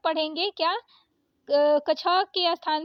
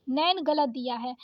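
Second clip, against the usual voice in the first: normal talking voice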